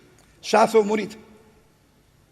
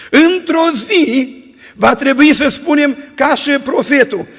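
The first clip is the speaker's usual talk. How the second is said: preaching at full force; 4 dB louder than usual. Acted. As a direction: build the closing blessing to the congregation. Closing exhortation of sermon.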